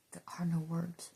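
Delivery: hushed, unvoiced whisper; soft whispered awe. Real, not acted. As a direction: breathe the words out. Whispered then repeated voiced.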